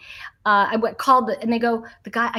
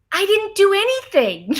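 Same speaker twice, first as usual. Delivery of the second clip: indignant; 9 semitones higher, 4 dB louder than usual. Retold or imitated. Retold protest about Mister Smith.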